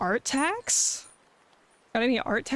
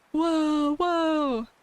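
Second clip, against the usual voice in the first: cute anime streamer; 5 semitones higher than usual. Real, not acted. Showing off room.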